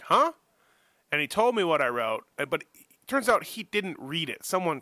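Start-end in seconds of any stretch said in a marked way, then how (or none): 0.0-0.3 high, sharp, louder huh of disbelief; surprised huh. Retold or imitated. Accused by a rider, a startled high huh. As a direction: yelp it like you can't believe your ears. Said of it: "And I'm like".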